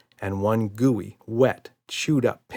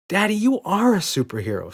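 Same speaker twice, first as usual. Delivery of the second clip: put-on high excited squeal of a little girl; thrilled little girl squeal; 10 semitones higher, 3 dB louder than usual. A narrator's reading. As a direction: squeal it with delight. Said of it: "squealed Emma".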